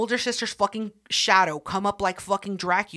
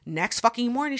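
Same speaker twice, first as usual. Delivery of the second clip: usual speaking voice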